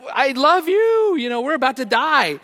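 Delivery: high-pitched farewell cry; hammed-up yelling as if about to die; mock panicked yell. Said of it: "So I'm yelling out".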